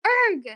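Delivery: high, loud straining grunt; effort grunt. Acted. Trapped Taylor tries to move the rock, grunting. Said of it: "she grunted as she tried".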